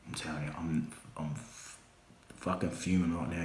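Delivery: hissed through fury, almost silent; seething whisper. Acted. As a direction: hold the rage down to a whisper. Seething promo, voice drops.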